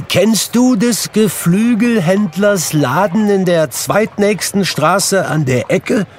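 high, loud eager question; loud, high-pitched and thrilled; excited Scrooge, calling. A narrator's reading. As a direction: call down eagerly to someone in the street. Scrooge calls down to boy.

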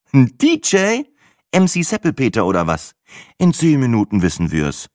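high scoffing question; mocking scepticism. A narrator's reading. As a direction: scoff at the idea. Matze mocks the name.

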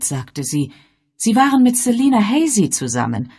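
deutlich höher, selbstbewusste Dame; elegante Dame. Acted. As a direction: weltgewandt und bestimmt, hoch. Lady Sedgwick voiced. (Bess Sedgwick spricht zum Inspektor, selbstbewusst)

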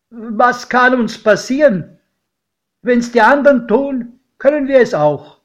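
high, loud sudden exclamation; calling out. A narrator's reading. Godfather to godchild at bedtime, suddenly calling out. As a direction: call it out boldly, pitch well up. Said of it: "da rief mein Pate".

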